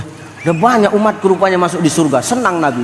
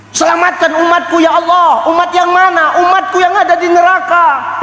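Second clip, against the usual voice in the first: impassioned plea; 10 semitones higher than usual. Acted. Prophet pleading to God for followers.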